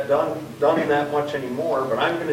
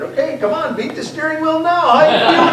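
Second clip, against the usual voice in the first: high, loud teasing; 10 dB louder than usual. Retold or imitated. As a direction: egg him on, gleefully. Teasing Derek loudly.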